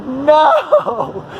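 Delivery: high, drawn-out NO; mock incredulous no. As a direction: exclaim a long, hammed-up refusal. Acted shocked refusal in retelling.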